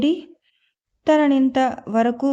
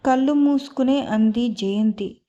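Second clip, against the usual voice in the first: usual speaking voice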